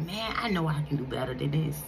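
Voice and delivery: low regretful self-talk. Retold or imitated. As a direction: mutter it low, full of regret. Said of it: "that like".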